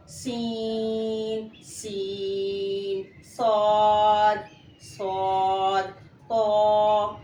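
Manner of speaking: each letter name held very long; slow letter recitation. Shown as a demonstration. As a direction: draw out सीन and सॉद like a teacher. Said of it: "जैसे". (अक्षरों के नाम बहुत खींचकर)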